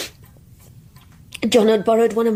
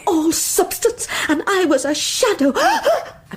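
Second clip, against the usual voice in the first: laughing through the bitterness; a bitter, giddy laugh running under the words; unhinged laugh; 7 semitones higher, slower than usual. Acted. Manic confession, laughing.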